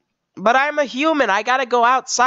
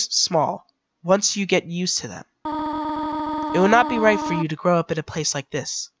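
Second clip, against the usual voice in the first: sad gentle mother; 5 dB quieter than usual. Acted. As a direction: let the child go with sorrow. Toriel's farewell speech.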